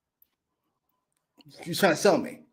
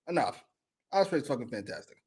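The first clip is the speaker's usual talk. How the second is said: normal talking voice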